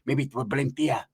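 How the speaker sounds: quiet scheming. Retold or imitated. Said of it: "And Pepe said".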